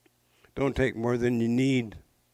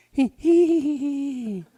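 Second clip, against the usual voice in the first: high, louder giddy giggle; giggling hee hee; 6 dB louder, slower than usual. Acted. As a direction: giggle ever more giddily. Lovestruck Muskrat giggles.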